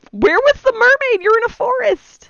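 high, loud incredulous objection; incredulous outburst. Real, not acted. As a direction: protest the absurdity, voice jumping right up.

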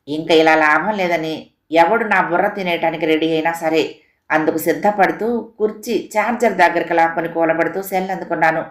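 ordinary delivery

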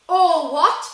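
thunderous, high shout; thundered. A narrator's reading. Hagrid outraged that Harry knows nothing, thundering. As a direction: roar it back in outrage. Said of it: "Hagrid thundered".

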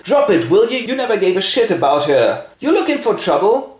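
high, forceful confrontation; angry Hamlet. Acted. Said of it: "Hamlet leaps forth".

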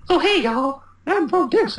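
very high put-on Texas voice; hammed-up Texas greeting, high pitched; Texas accent. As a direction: do a squeaky mock Texan. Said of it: "I didn't know how to do it again".

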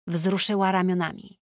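ordinary delivery, nothing marked

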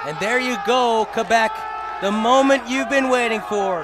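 high, loud celebration call; excited commentator shout. Real, not acted. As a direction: roar the championship call. Live play-by-play celebration.

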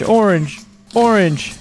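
ordinary talk, nothing marked

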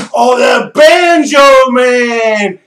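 normal talking voice

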